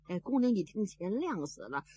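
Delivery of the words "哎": ordinary delivery, nothing marked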